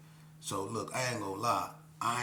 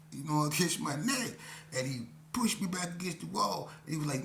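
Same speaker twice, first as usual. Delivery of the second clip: normal talking voice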